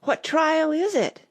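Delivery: high, slow question on the run; lightly acted breathless question; panting. A narrator's reading. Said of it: "Alice panted as she ran".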